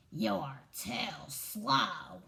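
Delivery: very slow, lumbering put-down; lumbering buffalo turning the squirrel away; dismissive buffalo. Acted. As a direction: let each word plod heavily.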